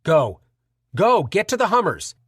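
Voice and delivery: urgent shouted command; shouted order. Acted. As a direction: yell at them to run. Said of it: "Carl shouted".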